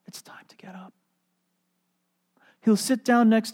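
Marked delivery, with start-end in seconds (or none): 0.1-0.9 put-on whispered wake-up to a child; gentle whisper. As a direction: softly whisper her awake. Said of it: "sit on the side of your bed and say".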